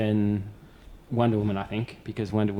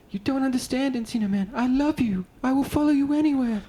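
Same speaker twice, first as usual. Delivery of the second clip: much higher, hammed-up tearful declaration; mock crying plea. The main guy begging Encino Man to stay, high mock-tearful. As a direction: overplay a sobbing goodbye. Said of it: "cries and goes".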